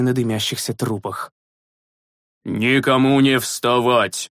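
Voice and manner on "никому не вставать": slow hoarse order; hoarse command. A narrator's reading. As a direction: rasp out a stern order. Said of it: "хриплым голосом приказал отец Флатис".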